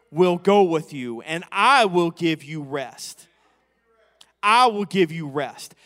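full-force preaching. Acted. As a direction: lean into the promise, slowly. Preacher reads promise with force.

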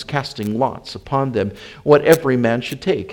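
ordinary delivery

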